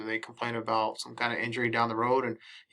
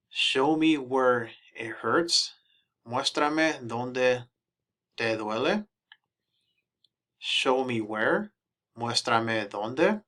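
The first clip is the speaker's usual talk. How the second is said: slow teaching read; much slower than usual. Shown as a demonstration. Demonstrating phrases slowly.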